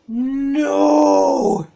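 loud despairing cry; anguished shout. Real, not acted. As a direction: yell it out in dismay. Losing in boss fight.

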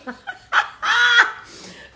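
real high-pitched cackle; high laughing shriek. Real, not acted. Host cracks up as her cousin joins, high laughter. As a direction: burst into squealing laughter.

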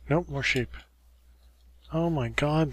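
normal talking voice

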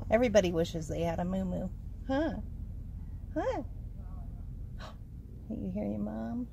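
normal talking voice

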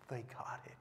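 hushed whisper. Real, not acted. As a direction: lean in and whisper it. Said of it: "whispered after oh".

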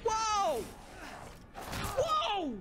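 high, falling shouts of alarm; alarmed yelps. Real, not acted. Alarmed by attack in game.

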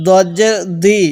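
ordinary talk

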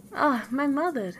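high, mildly plaintive reply; sad princess. A narrator's reading. Princess answers her weeping mother, mildly sad. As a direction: lift the voice into a light lament. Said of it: "replied Bellissima sadly".

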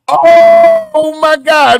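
high, loud, very slow drawn-out gasp; stunned shock. Acted. Reacting to the Meek claim, slow stunned shock. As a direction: stretch it in disbelief.

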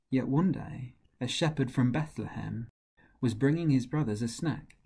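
plain reading voice

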